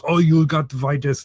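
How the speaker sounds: mock European accent; generic European accent. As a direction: imitate an excited European fan's accent. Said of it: "this is my generic European accent".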